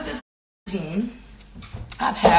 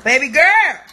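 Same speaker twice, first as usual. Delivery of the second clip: firm call out; 6 semitones higher, 4 dB louder than usual. Real, not acted. Telling daughter to back up.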